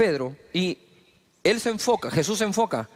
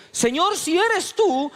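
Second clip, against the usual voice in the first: pleading call; 11 semitones higher, slower than usual. Acted. Peter calls out to Jesus.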